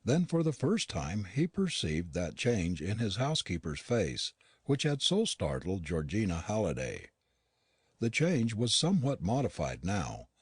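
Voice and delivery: ordinary delivery, nothing marked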